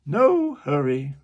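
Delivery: loud, slow carpenter voice; the carpenter booming reassurance. Acted. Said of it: "said the carpenter".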